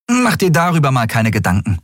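put-on high street-kid girl voice; lively young girl, matter-of-fact. A narrator's reading.

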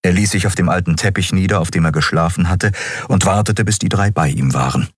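plain reading voice

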